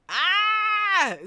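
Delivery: high, loud shriek of disbelief; real shocked shriek; excited scream. Real, not acted. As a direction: let out an overwhelmed squealing scream. Screams again over the goal.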